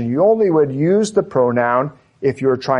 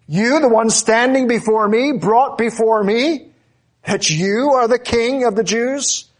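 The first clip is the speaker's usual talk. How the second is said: high, incredulous sneer of a Roman governor; scornful disbelief; 7 semitones higher than usual. Pretend. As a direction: scoff at the prisoner as if it's a joke. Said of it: "it's a question of contempt".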